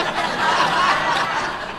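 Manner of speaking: high airless giggle; soft embarrassed laugh; breathy laugh. Real, not acted. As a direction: laugh at yourself. Laughing about Cookie.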